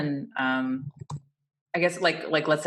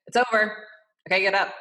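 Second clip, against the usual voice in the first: abrupt, louder and higher brush-off; blunt; 6 semitones higher, 4 dB louder than usual. Shown as a demonstration. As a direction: hurry them off the table. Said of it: "you don't wanna just be like".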